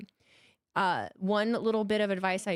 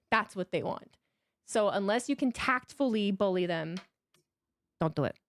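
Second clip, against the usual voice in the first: ordinary talk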